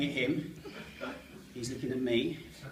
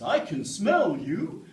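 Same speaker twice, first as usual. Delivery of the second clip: drawn-out, higher dog-character voice; talking dog from Up; 5 semitones higher, 7 dB louder, much slower than usual. Acted. Comedian showing audience the movie dog, drawn-out character voice. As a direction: stretch it out like a cartoon dog. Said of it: "kind of talks like this".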